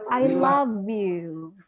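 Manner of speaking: "love" drawn out slowly with a clear v; correct model. Shown as a demonstration. Teacher correcting the class on "I love you", careful model. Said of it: "It should be".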